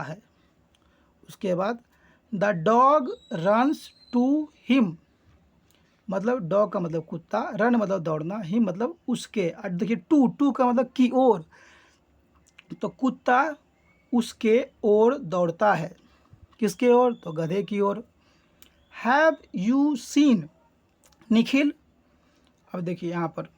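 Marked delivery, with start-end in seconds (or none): 2.3-4.9 slow reading. Shown as a demonstration. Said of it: "read-aloud story line, slow". 19.1-21.7 slow reading. Shown as a demonstration. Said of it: "reading dialogue line slowly".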